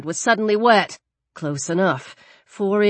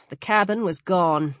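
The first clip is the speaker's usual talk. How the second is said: ordinary delivery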